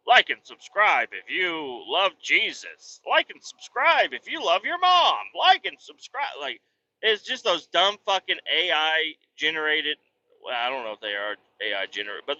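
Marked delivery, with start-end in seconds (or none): 0.1-6.3 slow, sing-song, much higher cheesy narrator; mocking video voice. Dad mocking the silly videos his son watches, sing-song. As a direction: parody a cheesy online short begging for likes. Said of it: "he likes to watch, like, all these videos".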